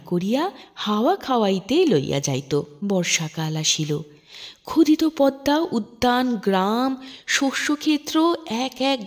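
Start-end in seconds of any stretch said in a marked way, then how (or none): none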